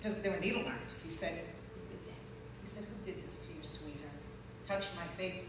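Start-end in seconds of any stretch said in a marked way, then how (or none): 1.3-4.1 soft, near-whispered concern; hushed tender. Retold or imitated. Kind man asking the hurt girl who did it, hushed. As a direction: breathe it gently, shocked and caring.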